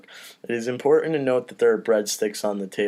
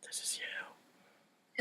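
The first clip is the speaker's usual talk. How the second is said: whisper; 19 dB quieter, much slower than usual. Acted. Whispered dramatic reveal.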